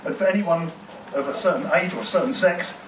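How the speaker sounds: ordinary delivery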